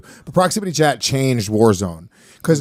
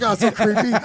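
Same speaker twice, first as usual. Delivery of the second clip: pinched voice; 9 semitones higher than usual. Retold or imitated. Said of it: "You're like".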